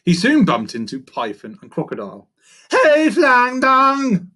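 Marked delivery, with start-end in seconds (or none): 2.7-4.3 high, loud, drawn-out monkey screech. A narrator's reading. Monkey confronting python and crocodile, screeched accusation. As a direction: screech the accusation slowly at both of them. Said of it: "Farley screeched at the both".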